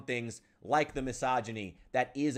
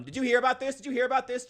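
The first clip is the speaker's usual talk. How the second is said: much higher and louder, imitating nagging liberal friends; mock whiny friend; 13 semitones higher, 6 dB louder, much faster than usual. Mocking liberal friends who bring him every Elon story. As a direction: parrot the friends as shrill busybodies. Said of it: "come to me every time something goes wrong".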